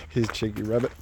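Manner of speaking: usual speaking voice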